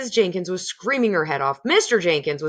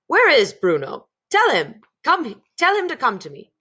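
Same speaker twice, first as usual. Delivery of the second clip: raised, higher demanding voice; 6 semitones higher, 5 dB louder than usual. A narrator's reading. Mr Jenkins calling for Bruno, raised and demanding. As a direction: bark the order for his son.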